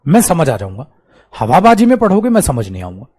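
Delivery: ordinary talk, nothing marked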